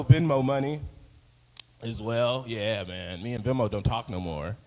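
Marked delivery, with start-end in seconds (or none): none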